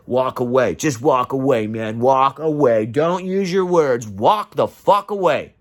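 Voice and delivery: ordinary talk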